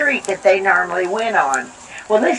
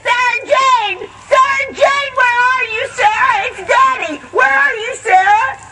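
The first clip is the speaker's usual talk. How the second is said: high desperate calls across the field, near crying; frantic hollering; 13 semitones higher than usual. Acted. Father searching the storm-wrecked field for his daughter, desperate high calls. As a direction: holler for a lost child, voice cracking high. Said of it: "he was hollering".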